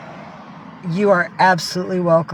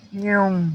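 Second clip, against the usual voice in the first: cat meow. Pretend. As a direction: meow back at the kitty. Meowing at her cat.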